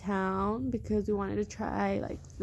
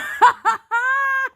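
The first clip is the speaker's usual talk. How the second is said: loud high laugh; 10 dB louder than usual. Real, not acted. After her own joke.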